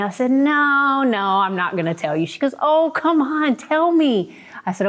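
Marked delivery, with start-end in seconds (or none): 0.3-2.2 retold refusal. Refusing to tell her friend. 2.6-4.3 high, insistent mock pleading; imitated coaxing. Friend begging to hear the secret, high insistent coaxing.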